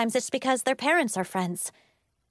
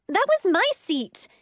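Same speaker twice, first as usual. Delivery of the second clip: high, whiny protest; petulant, high-pitched complaint; indignant squabbling; 10 semitones higher, 4 dB louder than usual. A narrator's reading. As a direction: squabble over what is yours. Kids squabbling over seats beside Becca.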